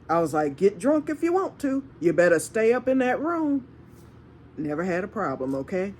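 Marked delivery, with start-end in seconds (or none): 0.6-3.7 higher-pitched, lilting mock warning; retold warning. Telling dorm drinkers to stay in that room, high-pitched retold warning. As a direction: lift the voice as you quote the warning.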